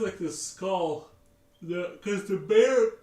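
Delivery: words stretched out through a real yawn. Real, not acted. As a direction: talk slowly mid-yawn. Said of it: "sorry, I don't know why I'm yawning".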